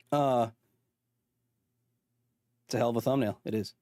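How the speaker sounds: ordinary talk, nothing marked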